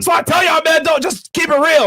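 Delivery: high excited shout. Real, not acted. Shouted advice with laugh.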